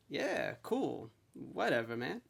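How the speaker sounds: laid-back stoner. Retold or imitated. Stoner texting his friend back, laid-back. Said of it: "smoking weed in his basement".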